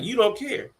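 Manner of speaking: high, whiny quote of the sister; mocking sister. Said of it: "repeating to her the words".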